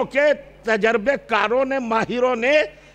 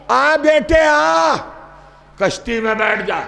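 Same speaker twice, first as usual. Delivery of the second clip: loud call; 3 semitones higher, 3 dB louder than usual. Acted. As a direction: stretch out the call to come. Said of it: "جناب نوح نے زور سے پکارا".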